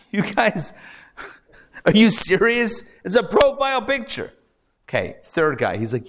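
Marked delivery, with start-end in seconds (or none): 0.1-4.3 much higher, laughing exasperation; laughing disbelief. Retold or imitated. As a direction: laugh at how dumb the recruits are.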